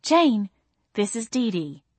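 high sweet mama coo; cooing mother. A narrator's reading. Mother presenting Dee Dee to her daughter, sweet coo. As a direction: coo the introduction. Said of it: "Mama cooed".

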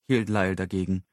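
ordinary delivery, nothing marked

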